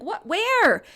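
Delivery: high, loud startled question; surprised what. Retold or imitated. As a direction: react as if caught off guard. Mom surprised by son.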